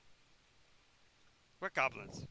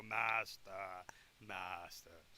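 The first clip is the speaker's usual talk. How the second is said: lightly voiced droning zombie moan, lower; mimicking broken record; 9 semitones lower, 4 dB quieter, much slower than usual. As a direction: repeat it like a stuck record. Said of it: "sit there and go".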